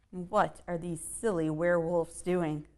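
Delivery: ordinary delivery, nothing marked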